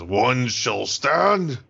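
very slow, grand heroic declaration; Mario as epic hero. Pretend. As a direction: proclaim it like a mock showdown challenge. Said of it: "he points it to Donkey Kong and says".

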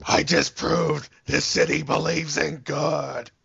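slow gravelly Batman growl. Acted. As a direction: brood it out like Batman. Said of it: "too much of a Batman reference".

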